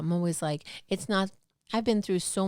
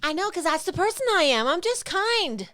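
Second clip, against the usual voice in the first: high-pitched, louder self-defense; pleading protest; 10 semitones higher, 4 dB louder than usual. Real, not acted. Defending their sympathy for the ex's new mate, pleading protest. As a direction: protest in a high voice, drawing out the last words.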